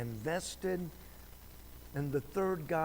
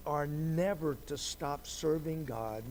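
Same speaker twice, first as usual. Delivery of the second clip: ordinary talk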